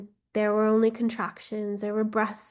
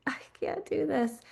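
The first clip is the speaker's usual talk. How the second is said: scared whisper; 4 semitones higher, 4 dB quieter than usual. Acted. Frightened refusal, shaking her head.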